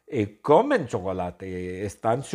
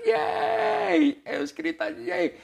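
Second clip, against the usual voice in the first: high squealed yay; crowd cheer; slower than usual. Acted. Teacher voicing the festival crowd cheering, high.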